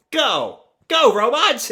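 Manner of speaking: high-pitched playful command; excited command. Acted. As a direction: order the robot into action. Luffy commands the giant robot.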